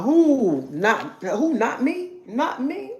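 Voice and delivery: high, rising mock innocence; feigned innocence. Mocking a woman who denies it, high coy innocence. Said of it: "Don't be trying".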